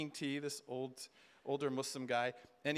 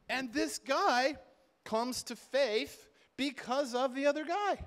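slow emphatic; 8 semitones higher, 3 dB louder, slower than usual. Acted. Sermon climax, drawn out.

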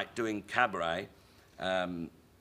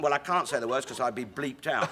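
usual speaking voice

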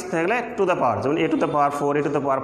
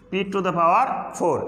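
slow, careful "P to the power four"; teacher modelling the phrase slowly; slow demo; much slower than usual. Shown as a demonstration. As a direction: spell it out slowly for the class. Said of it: "এটার উচ্চারণ কী করতে হয়".

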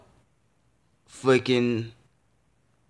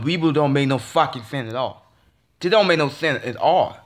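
ordinary talk

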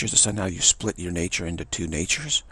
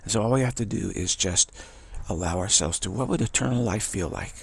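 usual speaking voice

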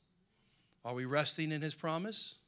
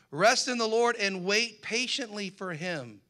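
loud, higher, slow verse reading; preaching proclamation; 6 semitones higher, 9 dB louder than usual. Acted. Preacher proclaiming scripture to the church, loud and slow.

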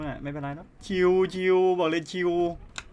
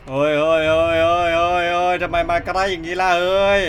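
panicked cries; 10 dB louder than usual. Real, not acted. Monster rushing at him.

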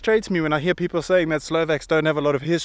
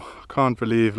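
usual speaking voice

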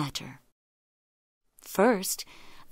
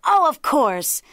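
loud, high realization; crying out; 6 semitones higher, 7 dB louder, slower than usual. A narrator's reading. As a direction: exclaim it as the muddled jackal. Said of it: "cried the jackal".

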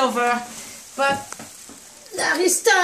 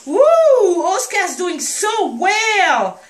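high whoop into slow, drawn-out praise; delighted cheer; 5 semitones higher, 5 dB louder, slower than usual. Real, not acted. Owner praising puppy Oscar for eating well, delighted cheer. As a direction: cheer a little one on, high and stretched.

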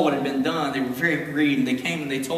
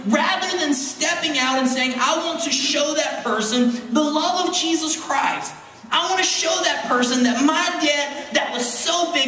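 raised, building preacher voice; preaching; 8 semitones higher than usual. Real, not acted. Pastor pressing the church on forgiveness, fervent preaching. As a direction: preach it to them with rising fire.

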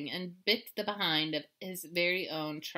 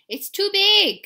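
high, loud whiny kitten; complaining; 12 semitones higher, 13 dB louder, slower than usual. Acted. Kitten complaining about the costume, loud whine. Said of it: "complained the gatito".

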